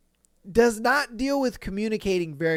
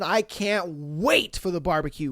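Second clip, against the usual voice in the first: ordinary talk, nothing marked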